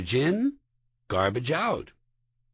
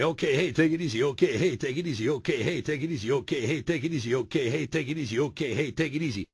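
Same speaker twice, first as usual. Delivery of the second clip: stuck-in-a-rut goodbye; 3 semitones higher than usual. Retold or imitated. Partygoer leaving a group, repeating the same goodbye mechanically. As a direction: mumble the same goodbye over and over, running out of steam. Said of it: "you feel like a goddamn moron".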